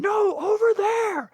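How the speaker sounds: very high, slow mock yell; high-pitched retold screaming, drawn out; imitated crowd screaming. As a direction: yell in alarm like a panicked spectator. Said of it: "we're all yelling and screaming".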